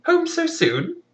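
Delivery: fake-innocent wife. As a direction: squeak out a guilty cover-up greeting. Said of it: "she runs up and is like".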